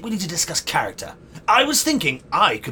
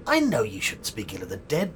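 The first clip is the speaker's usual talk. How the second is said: usual speaking voice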